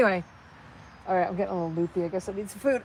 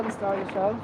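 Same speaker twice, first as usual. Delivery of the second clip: normal talking voice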